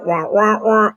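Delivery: muffled trombone-like wah wah; Charlie Brown adult wah-wah. Retold or imitated. Telling how she sounded meeting her hero, cartoon wah-wah. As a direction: mimic the garbled cartoon grown-up. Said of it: "Or the Charlie Brown".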